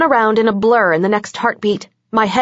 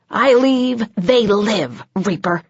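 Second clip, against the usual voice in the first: hissed threat; slower than usual. A narrator's reading. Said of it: "Nick hissed".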